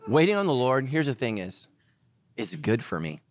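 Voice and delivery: ordinary delivery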